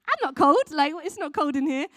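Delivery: high, loud puzzled objection; literal-minded protest. Acted. Acting a child taking slang literally.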